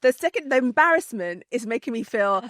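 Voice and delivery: high, loud, laughing with embarrassment; really laughing to the point of tears, mortified; tearing up laughing. Real, not acted. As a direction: push the words through embarrassed laughter. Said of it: "I'm literally tearing up now".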